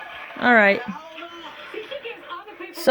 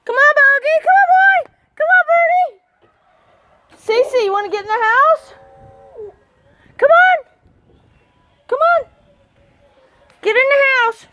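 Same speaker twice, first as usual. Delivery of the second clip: much higher, cooing voice for the dogs; doggy talk; 5 dB louder, faster than usual. Acted. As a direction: coo at the dogs like babies. Calling dogs in pet voice.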